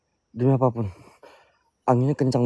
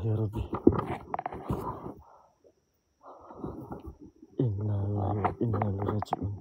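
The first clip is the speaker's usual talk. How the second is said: low hushed murmured prayers; whispered prayer; 4 semitones lower, 12 dB quieter than usual. Real, not acted. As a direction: pray under your breath, scared. Berdoa ketakutan di kuburan. (doa berbisik ketakutan)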